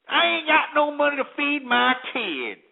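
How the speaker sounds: high, whining mock complaint; whiny mimicry. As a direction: mimic a broke parent whining.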